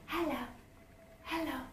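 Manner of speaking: a hint of a cheeky greeting; dry skin saying hello. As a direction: give the dry skin a little teasing voice. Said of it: "the dry skin says".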